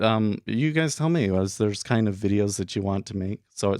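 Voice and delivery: ordinary talk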